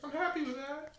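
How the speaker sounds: squeaky high cartoon voice; silly high voice. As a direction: play a squeaky little team mascot. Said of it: "that stupid voice".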